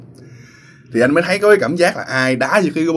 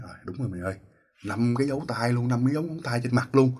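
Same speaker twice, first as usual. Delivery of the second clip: stunned hush; 8 dB quieter than usual. Retold or imitated. Friend sees handprints on face.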